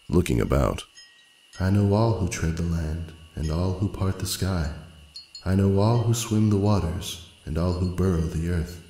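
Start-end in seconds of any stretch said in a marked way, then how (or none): 1.6-8.8 soft, higher, airy voice of a timeless spirit; ethereal pool spirit. Acted. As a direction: murmur it as if from everywhere. The pool of life speaks.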